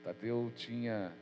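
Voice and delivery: ordinary talk, nothing marked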